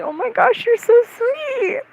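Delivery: mock sweet, high gushing; gushing fangirl. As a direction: imitate someone cooing in a high voice. Quoting cosplayer gushing over them.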